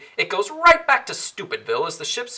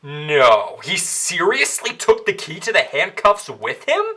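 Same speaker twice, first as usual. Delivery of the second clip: normal talking voice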